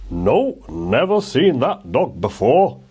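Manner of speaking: lightly voiced shopkeeper, slow; a shopkeeper shrugging it off, slowly; shopkeepers' reply. A narrator's reading. Shopkeepers answering Oliver about the dog, lightly voiced and slow. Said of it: "the shopkeepers said".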